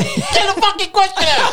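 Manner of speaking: high, drawn-out mock shout; high-pitched mock outburst; mock exasperated yell. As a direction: yell it in comic impatience. Mock impatience at host rambling.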